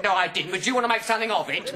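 high, loud comeback; belligerent conductor. Retold or imitated. Conductor to passenger, spoiling for a fight. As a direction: square up for a row. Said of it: "the conductor said".

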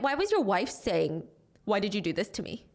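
usual speaking voice

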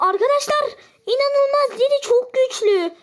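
real excitement at the play; excited exclaim. Real, not acted. Amazed at a strong teammate. (heyecanlı, şaşkın övgü)

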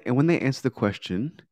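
ordinary talk

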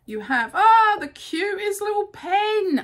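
squealing high with delight over the pen; high-pitched cute-overload squeal; gushing delight. Real, not acted.

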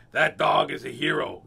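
loud, bold proclamation; booming storybook captain; captain declaring. Acted. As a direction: declare it loudly like a ship's captain. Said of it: "the captain declared".

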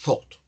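"thought" ending in an audible T; short "thought" closed by a clear T; final T sounded. Shown as a demonstration. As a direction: release the T at the end of "thought". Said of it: "la T tiene que sonar al final".

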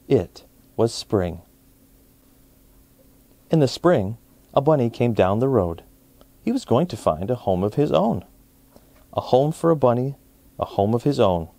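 ordinary delivery, nothing marked

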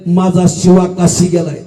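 low, mournful close; grieving realisation. Acted. Mother realises her son died.

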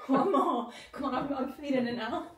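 laughing. Real, not acted. Laughing after success.

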